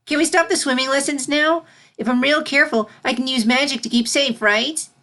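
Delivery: put-on a child begging to skip lessons; eager. Filly pleading with Celestia to stop swimming, high and eager. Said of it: "eagerness reigniting".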